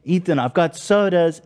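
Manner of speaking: imitating an excited roommate, high-pitched; high, gleeful call bursting through the door. Roommate bursting in on Ethan with orange sodas, imitated excitedly. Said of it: "and he's all excited".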